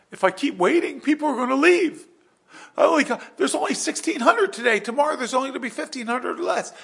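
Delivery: very high, loud, rushing worry; panicked reasoning. Acted. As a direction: spill it out as a frightened king counting losses. Saul's panicked thoughts acted out.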